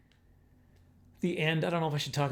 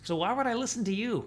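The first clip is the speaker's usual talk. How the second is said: dog's voice; 8 semitones higher than usual. Retold or imitated. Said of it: "Chico was like".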